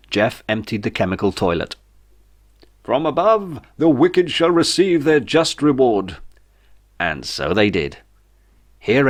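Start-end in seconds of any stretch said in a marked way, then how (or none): 2.8-6.2 mock preacher. A narrator's reading. Jeff pronounces biblical judgement from above.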